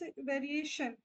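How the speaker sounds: normal talking voice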